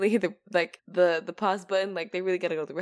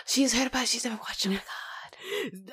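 shocked whisper; 3 semitones higher, 5 dB quieter than usual. Real, not acted. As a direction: whisper it, aghast. Mock shock at friend.

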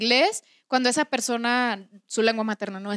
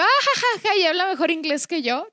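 much higher, giggly retold reaction; high fake-cheerful laughing quote; mock laugh; 6 semitones higher than usual. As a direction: replay your own silly laugh. Retells her own reaction, laughing.

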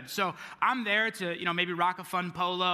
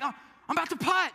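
high, fast imitated fussing; quick high-pitched mock complaint; mock indignant; 11 semitones higher, much faster than usual. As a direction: fuss like a golfer disturbed mid-putt. Imitating annoyed golfer about to putt.

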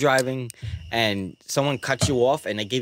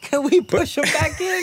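high, laughing question; real laughter; 13 semitones higher than usual. Real, not acted. Brother joining the joke about the child, laughing. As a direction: squeak it out through real laughter.

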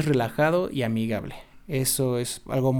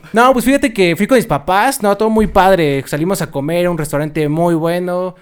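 enthusiastic; 6 dB louder than usual. Shown as a demonstration. Telling his crush about a great weekend, enthusiastic. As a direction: launch in with big energy, easing toward the end. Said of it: "pues, más entusiasmado".

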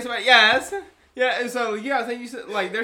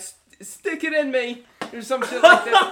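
mock British; 7 semitones higher, 8 dB louder than usual. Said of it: "they be like".

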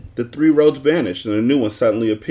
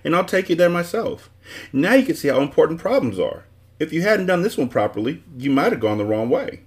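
lightly voiced, higher-pitched; self-satisfied dodecahedron; 7 semitones higher than usual. A narrator's reading. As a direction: explain with a pleased, lecturing lilt.

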